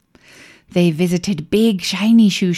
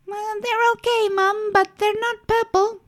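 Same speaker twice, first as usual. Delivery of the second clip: put-on high, hesitant child voice; unimpressed little girl, hesitating; 4 dB quieter than usual.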